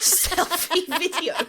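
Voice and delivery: high, loud, drawn-out mocking echo; laughing mockery. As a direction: crack up while teasing their wording, stretching it out. Mocking friend's outdated term, laughing.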